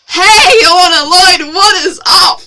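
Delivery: very loud, high-pitched hello; shouted greeting. Real, not acted. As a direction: yell an over-the-top hello to whoever walked in. Yells greeting to someone walking in.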